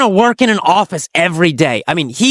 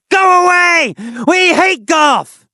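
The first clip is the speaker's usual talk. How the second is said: high, drawn-out shout of rejection; angry yell; 10 semitones higher, slower than usual. A narrator's reading. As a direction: yell it like an angry crowd driving someone off. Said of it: "They'll yell".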